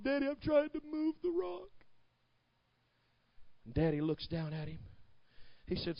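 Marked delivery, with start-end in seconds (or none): 0.0-1.7 high, put-on small child's voice; tearful little boy. Little boy telling his dad about the stuck rock, mock tearful. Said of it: "he's got little tears in his eyes".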